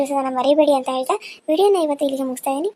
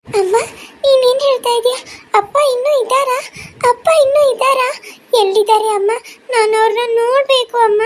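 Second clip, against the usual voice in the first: put-on high-pitched child's voice, imploring; pleading young daughter; 9 semitones higher, 4 dB louder than usual. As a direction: beg mother like a stunned little girl. (ಅಮ್ಮನನ್ನು ಬೇಡುವ ಮಗುವಿನ ಎತ್ತರದ ಧ್ವನಿ)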